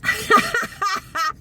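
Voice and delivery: high breathy laugh at the memory; bright chuckle; real laugh. Real, not acted. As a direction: let a real laugh slip out.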